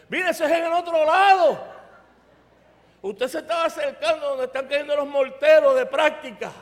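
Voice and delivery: loud, high military reprimand; re-enacted yelling of an exasperated sergeant; scolding sergeant shout. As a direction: order the lost chaplain about sharply. Sergeant scolds the lost chaplain.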